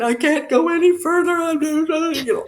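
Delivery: mock a tearful breakdown on the roadside; retold sobbing. Exhausted cyclist telling husband she can't go on, mock sobbing. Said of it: "I'm sobbing".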